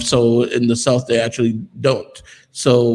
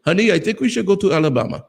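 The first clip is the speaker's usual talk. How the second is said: a light mock dad voice, quick and higher; imitating dad; 7 semitones higher, faster than usual. Father telling his wife they should move, in a light mock voice. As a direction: breezily play the father springing the news.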